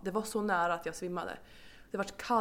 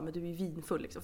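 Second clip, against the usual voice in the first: lightly voiced husband; 4 semitones lower, 6 dB quieter, faster than usual. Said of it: "Och han bara".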